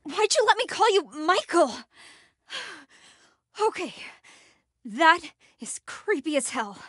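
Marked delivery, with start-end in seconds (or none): none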